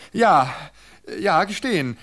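high, loud, urgent; desperate insistence. Acted. Wife desperately holding his attention, high urgent insistence.

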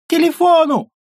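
very high shrieking yell. Acted. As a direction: scream it over the gunfire. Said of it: "и орёт".

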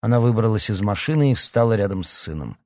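ordinary delivery, nothing marked

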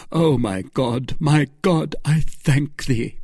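a mild cry of thanks to God; understated heartfelt relief; relieved cry. A narrator's reading. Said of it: "cried the young man".

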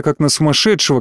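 ordinary delivery

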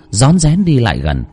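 plain reading voice

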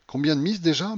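high-pitched impatient question. A narrator's reading. Old gambler asking how many bets are lost, impatient. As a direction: demand the count impatiently. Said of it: "en grinçant des dents d'impatience".

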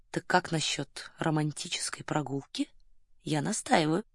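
low, half-whispered proposition; hushed insistence. A narrator's reading. Colonel pressing Varya for a romantic outing, hushed.

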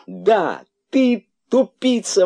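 высокий, громкий, протяжный восторг; выкрикни ликуя, растягивая слова; восторженный выкрик. A narrator's reading. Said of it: "восторженно выкрикнул кролик". (восторженно кричит)

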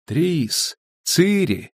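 high, echo-like calls of names; plaintive, high name-calling. A narrator's reading. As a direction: call from far away in a dream. Voices call through the vision.

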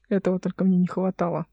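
understated, muttered exasperation; mildly muffled moan of annoyance; low grumble. A narrator's reading. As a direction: grumble it under your breath. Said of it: "промычала Вердана".

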